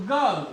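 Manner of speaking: गर्ल said with no r sound; गर्ल, the r dropped before l; girl without r. Shown as a demonstration. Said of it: "मोनोपेथोंग है". (गर्ल बिना र के)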